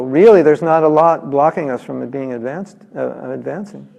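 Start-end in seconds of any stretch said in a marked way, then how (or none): none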